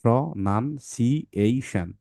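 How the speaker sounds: Pronunciation said slowly, parts joined; slow syllables. Shown as a demonstration. Teacher repeats the word for parents, slowly. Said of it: "এটা ভাঙতে হয় এভাবে".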